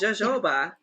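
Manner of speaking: jojoba as "zhuh-ZHO-bah"; J sound. Shown as a demonstration. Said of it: "then it is a J sound".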